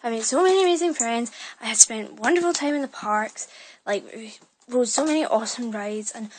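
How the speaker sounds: normal talking voice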